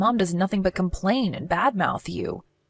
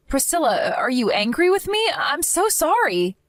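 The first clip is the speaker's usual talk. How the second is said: anxious apology; 8 semitones higher than usual. Acted. Timid wife fearing her sister-in-law is angry, pleading apology. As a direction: beg forgiveness in a small, frightened plea.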